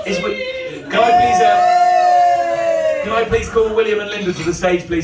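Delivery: rousing shout for applause; calling to the stage. Real, not acted. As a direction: project it over the crowd to summon the acts. Host calling acts up to stage.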